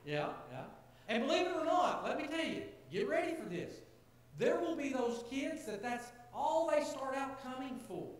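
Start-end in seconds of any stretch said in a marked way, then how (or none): 1.1-8.1 much higher and louder, building; rising preacher fervor; excited emphasis. Real, not acted. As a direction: lift it up to the rafters.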